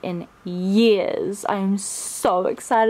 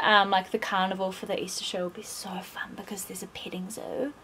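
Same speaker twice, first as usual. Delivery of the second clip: whisper; 4 semitones lower, 10 dB quieter than usual. Real, not acted. Excited secret whisper to camera.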